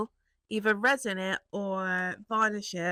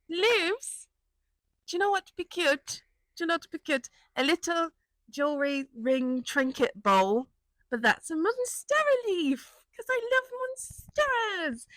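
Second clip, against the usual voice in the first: excited idea; 7 semitones higher than usual. Retold or imitated. Excited inner monologue about idea.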